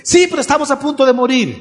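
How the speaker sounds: high, loud and fast panic; frightened disciples arguing with Jesus; frantic protest. Acted. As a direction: blurt it out, sure you're about to drown.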